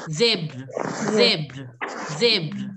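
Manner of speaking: Zebra as "zebr", low and repeated; drilled pronunciation. Shown as a demonstration. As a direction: repeat Zebra dropping low, no vowel after br. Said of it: "it has to come the way".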